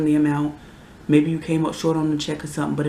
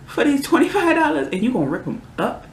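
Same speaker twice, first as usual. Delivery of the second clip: higher, louder, fast outraged rant; indignant rant; 8 semitones higher, 4 dB louder, faster than usual. Real, not acted. Mother ranting at the ungrateful child, loud, high and fast. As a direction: build real outrage as you speak.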